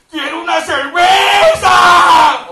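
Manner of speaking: high, very slow bellowed demand; dubbed line shouted and stretched like the original; drawn-out shout. Shown as a demonstration. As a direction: yell it and stretch it long. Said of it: "y lo grita y lo alarga".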